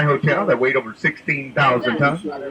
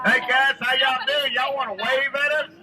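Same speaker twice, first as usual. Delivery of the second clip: calling from far away; 9 semitones higher, slower than usual. Real, not acted. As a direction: call out to strangers on the bank. Calling to people on shore.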